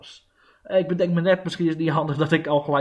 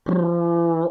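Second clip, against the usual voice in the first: lip trill; 4 dB louder than usual. Acted. Builds up to a closing quote.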